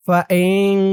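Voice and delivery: ফাইন with the final n hummed long inside the nose; nasal ghunna. Shown as a demonstration. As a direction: hide the n of ফাইন in a held nasal hum. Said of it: "নাকের ভিতরে লুকিয়ে গুন্না করতে হবে".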